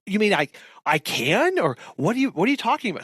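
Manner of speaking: surprised joy. Retold or imitated. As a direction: sound delighted and unbelieving. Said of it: "that inflection in their voice where they go".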